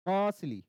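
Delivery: मर्सली with the weight on म and an unstressed ली; stress on first syllable. Shown as a demonstration. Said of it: "म पे ज्यादा जोर आ रहा है". (मर्सली के पहले हिस्से म पर ज़ोर)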